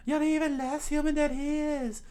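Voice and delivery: shrieky falsetto yell, loud and very high. A narrator's reading. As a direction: yell back in a high put-on girl's voice. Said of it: "Nami yelled out".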